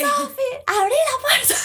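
loud, high call through a door; high yell of a name. Retold or imitated. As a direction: shout her name from outside. Friends calling from outside.

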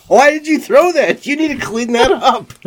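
high-pitched, loud fake outrage; mock indignant scolding. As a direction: scold in an exaggerated high voice, playing innocent. Retells mock scolding after own prank.

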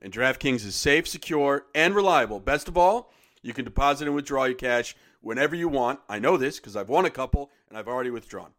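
upbeat sponsor read, punchy. Acted. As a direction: sell it like a radio spot.

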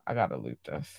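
low, quiet aside to herself; low muttered aside. Real, not acted. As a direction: mumble it off to the side. Aside while looting something.

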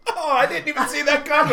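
high, laughing mock amazement; feigned surprise. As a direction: pretend the old joke caught you out. Mock surprise at familiar joke.

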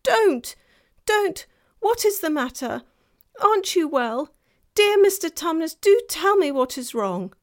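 high, loud, pleading; distressed. A narrator's reading. Lucy comforting the faun, high distressed pleading. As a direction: beg him to tell you. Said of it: "in great distress".

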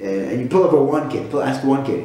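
normal talking voice

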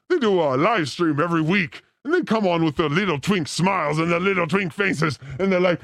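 ordinary talk, nothing marked

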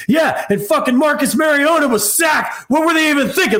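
random rage scream. Retold or imitated. Husband screaming mid-argument with wife, furious shout. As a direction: yell it out of nowhere in a rage. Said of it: "I'll just randomly scream".

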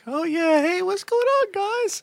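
high-pitched friendly hello to the guys; lighter daytime voice. Shown as a demonstration. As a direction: pitch it way up like a cheerful greeting. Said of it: "later on during the day".

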